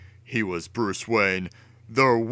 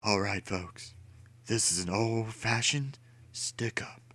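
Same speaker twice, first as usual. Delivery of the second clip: softer, breathy menacing growl; Two-Face growl; 10 dB quieter than usual. A narrator's reading. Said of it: "Two-Face growled".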